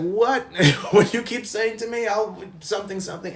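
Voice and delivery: high, loud challenging what; angry cab driver. Retold or imitated. Cab driver challenging Drew, high and loud. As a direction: snap back at the passenger. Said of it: "The guy was like".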